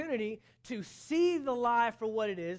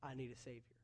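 a soft, much quieter admission, dropping away on "I need a Savior"; a lowered, humbled sinner's voice; hushed confession; 6 semitones lower, 10 dB quieter than usual. Retold or imitated. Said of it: "then you realize".

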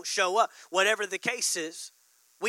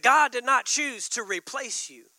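repeated louder for emphasis; emphatic repeat; 5 semitones higher, 4 dB louder than usual. Shown as a demonstration. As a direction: say it again, pushing it home. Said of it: "I said".

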